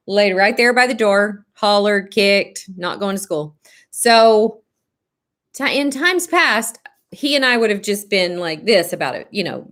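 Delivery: normal talking voice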